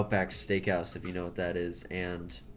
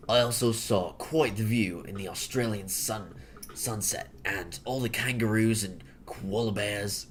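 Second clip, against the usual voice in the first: hammed-up Aussie accent; mock Australian accent. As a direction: play an Australian recounting a trip. Continues the Australian act.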